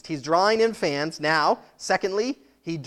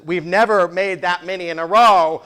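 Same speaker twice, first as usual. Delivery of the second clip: loud, panicked repeat; frantic ice cream lady, much louder; terrified server; 9 dB louder than usual. Retold or imitated. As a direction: blurt it out like a frightened cashier. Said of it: "looks terrified and she says".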